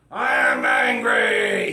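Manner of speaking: huge low roaring shout, drawn out; angry dragon. Acted. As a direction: roar it slowly as a furious dragon. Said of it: "he was angry".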